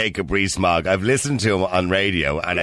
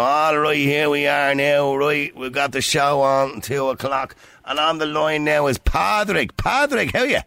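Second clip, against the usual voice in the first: put-on slow, higher-pitched broad Irish accent radio host; exaggerated local accent; 6 semitones higher, slower than usual. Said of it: "you're not gonna hear a presenter be successful".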